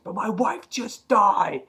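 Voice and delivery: high, drawn-out retold protest; slow it down and push the pitch way up; anguished protest. Widowed Alan protesting to Andrea, anguished.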